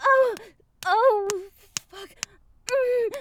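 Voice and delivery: high, breathy cries of pleasure, rising; pleasured moaning. Acted. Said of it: "Oh, that's so good".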